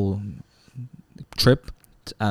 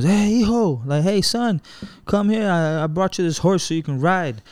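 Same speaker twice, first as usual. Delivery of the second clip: higher, friendly dad voice calling out; dad calling him over; 8 semitones higher than usual. Retold or imitated. Father calling his little son over to the horse, higher and friendly. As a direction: call the boy over like a tipsy dad. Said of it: "And he goes".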